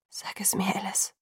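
soft, hushed plea; whisper. A narrator's reading. Bella begging Alice to tell her, whispered. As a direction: beg under your breath. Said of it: "flüsterte ich".

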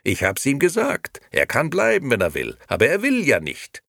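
loud, high self-justification; grand concession. A narrator's reading. Nino shifts the blame onto the uncle, grandly. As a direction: insist with a flourish. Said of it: "erwiderte Nino mit großer Geste".

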